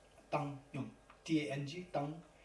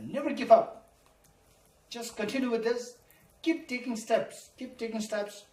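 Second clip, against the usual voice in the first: loud, high, urging them never to give up; rally them, much louder and higher; urgent exhortation; 9 semitones higher, 9 dB louder than usual. Real, not acted. Exhorting students to persevere.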